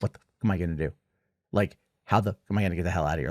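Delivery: low, soft worried muttering to herself; quiet panicked thinking aloud; imitating inner panic. Mitzi outside the house, quiet and panicked. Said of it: "you can tell she is like".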